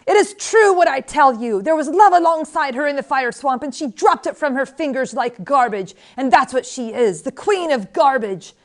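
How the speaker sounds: high, loud public denunciation; yelling. A narrator's reading. Old woman turns to the crowd against the queen, yelling. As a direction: proclaim her shame to the crowd. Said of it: "suddenly she was yelling".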